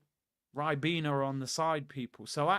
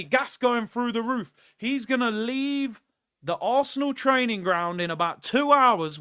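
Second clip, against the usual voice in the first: worked up; 7 semitones higher, 6 dB louder than usual. Real, not acted. Mock rant at overpaid Havertz.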